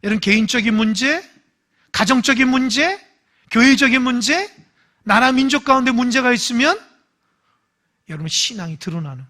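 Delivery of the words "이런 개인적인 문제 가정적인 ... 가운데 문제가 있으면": rising preacher's list, louder each time; fervent sermon delivery; preaching. Real, not acted. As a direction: build the list from the pulpit.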